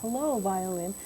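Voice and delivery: soft greeting. Shown as a demonstration. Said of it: "let's all say".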